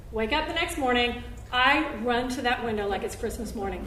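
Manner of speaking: ordinary talk, nothing marked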